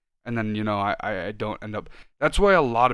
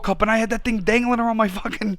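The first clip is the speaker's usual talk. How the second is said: excited boast; 11 semitones higher, 7 dB louder than usual. Real, not acted. Boasting about wearing the Italian flag.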